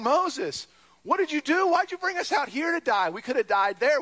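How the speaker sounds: high, frantic plea to Moses; panicked complaint. Retold or imitated. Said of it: "they're terrified".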